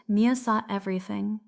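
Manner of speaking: ordinary delivery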